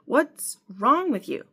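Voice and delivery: high, slow, exasperated question; exasperated demand. Acted. Quarrelling couple, one pulling at the other, exasperated. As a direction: stretch it out in exasperation. Acted quarrel, husband and wife.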